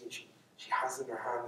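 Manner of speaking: ordinary delivery, nothing marked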